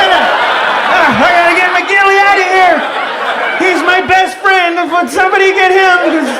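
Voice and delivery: high, loud plea over a wounded friend; hammed-up panicked battlefield yelling; acted frantic shout. Acts frantic combat rescue shouting.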